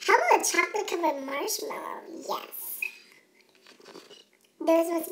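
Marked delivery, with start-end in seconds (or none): 2.3-2.8 whispered, breathy little yes. Acted. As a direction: whisper it with delight. Delighted over chosen snack.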